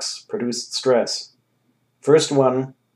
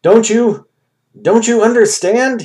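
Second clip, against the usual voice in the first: much higher and louder, desperate plea; begging them to understand; pleading; 10 semitones higher, 5 dB louder than usual. A narrator's reading. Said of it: "he pleaded".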